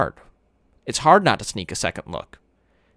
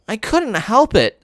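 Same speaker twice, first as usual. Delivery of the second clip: whining; 11 semitones higher, 4 dB louder, slower than usual. Acted. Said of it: "kind of whining".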